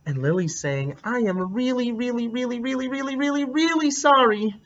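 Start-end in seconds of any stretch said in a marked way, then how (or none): 1.0-4.5 put-on much higher, little girl's pleading voice; pleading apology. Lily apologizing in her story, high and pleading. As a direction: beg forgiveness like a small child.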